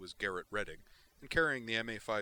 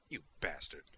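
venomous; 3 semitones lower than usual. Acted. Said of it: "Melandra replied venomously".

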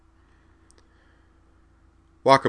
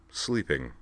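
dry, softer one-word punchline; dry reply; 3 semitones lower, 5 dB quieter than usual. A narrator's reading. Man on the floor finishing his answer, dryly. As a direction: drop it softly and dryly. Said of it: "replied dryly".

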